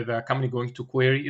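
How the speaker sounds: usual speaking voice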